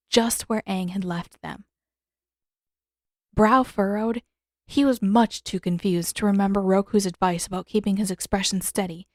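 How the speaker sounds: ordinary delivery, nothing marked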